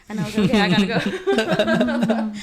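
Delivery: loud, slow, laughing through it; drawn-out laughing exit; laughing loudly. Retold or imitated. Girl rushing out to meet her date, loud laughing drawl.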